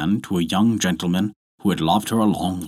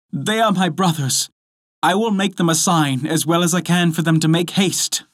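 high, lightly voiced eager woman; pitched up with urgent relief; hopeful wife; 8 semitones higher than usual. A narrator's reading. Wife signalling her brothers to hurry, hopeful.

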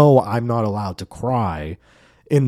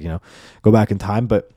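normal talking voice

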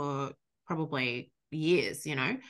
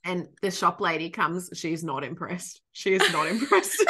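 usual speaking voice

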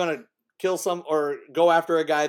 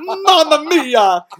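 high, slow, loud cartoon Italian accent; drawn-out, pitched-up mock Italian Mamma Mia; 8 semitones higher, 7 dB louder, much slower than usual. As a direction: Mamma Mia, like a hammed-up Mario. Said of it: "go full fucking Mario".

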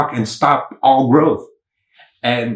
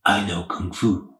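lower, laid-back surfer-dude Neo; Keanu Reeves impression; 4 semitones lower, 6 dB quieter, slower than usual. Vlogger redoing Neo's line, hammed-up lower Keanu voice. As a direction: drop the voice and lean into a mellow dude impression. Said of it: "that's my best Keanu Reeves voice ever".

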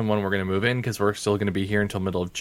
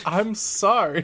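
mocking Emma Stone; 10 semitones higher, much slower than usual. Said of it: "she's just like".

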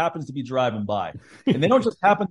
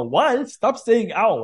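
higher, annoyed mock scold; mom scolding; 7 semitones higher, much slower than usual. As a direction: play the exasperated mom. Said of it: "my mom would go".

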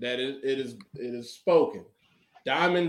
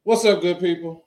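ordinary talk

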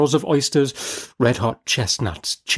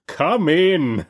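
booming jolly giant spirit; ghost exclaiming; 6 semitones higher than usual. A narrator's reading. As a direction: call him in, big and hearty. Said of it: "exclaimed the ghost".

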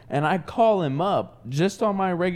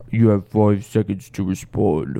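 low, flat menacing threat; deadpan threat; 8 semitones lower, 4 dB louder than usual. Retold or imitated. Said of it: "It was a voice changer app".

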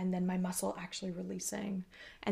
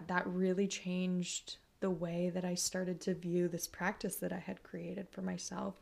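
usual speaking voice

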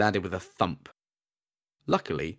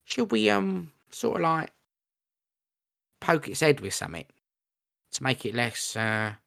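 goblin voice; 7 semitones higher than usual. Acted. Goblin speaks hesitantly.

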